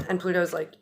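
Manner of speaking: usual speaking voice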